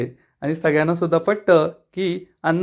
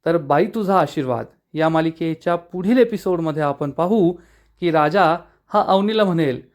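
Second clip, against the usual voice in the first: ordinary delivery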